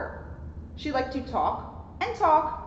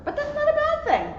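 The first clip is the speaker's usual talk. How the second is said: much higher emphatic voice; high-pitched; 13 semitones higher than usual. Acted. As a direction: lift it way up. Emphatic message to kids.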